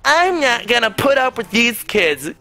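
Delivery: high-pitched imitated outraged mother; hammed-up parental outrage; mock angry mom. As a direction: put on an indignant defensive-mom voice. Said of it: "she flips shit".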